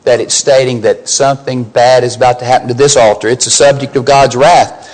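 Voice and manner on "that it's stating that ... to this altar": normal talking voice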